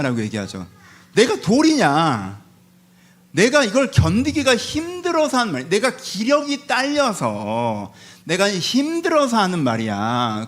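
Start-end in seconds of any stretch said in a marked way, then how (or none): 1.1-10.5 slow, stretched-out plaintive protest; exasperated protest. Acted. Job protests to friends that he has no strength, exasperated. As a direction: drag out the words, pleading to be understood.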